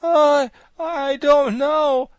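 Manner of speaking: slow, high, loud moan; drawn-out cry of suffering; agonized. A narrator's reading. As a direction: wail through the pain. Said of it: "suffer such fearful pains".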